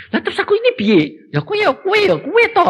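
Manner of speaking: high whiny son's voice; fretting son. Retold or imitated. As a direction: squeeze it up high and plaintive. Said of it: "dia bilang gini".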